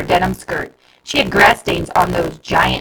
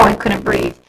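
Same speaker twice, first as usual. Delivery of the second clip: ordinary delivery, nothing marked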